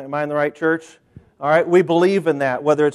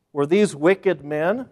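ordinary talk, nothing marked

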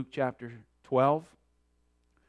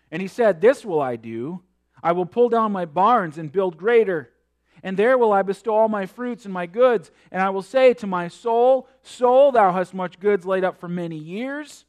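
preaching scripture; 8 semitones higher, 12 dB louder than usual. Acted. Reading scripture with force.

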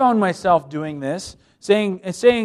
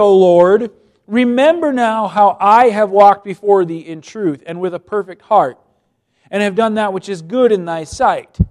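loud, higher reading of the prayer; preacher reading scripture aloud; 4 semitones higher, 9 dB louder than usual. Acted. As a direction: proclaim the verse with weight.

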